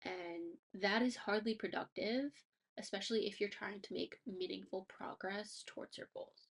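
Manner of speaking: ordinary talk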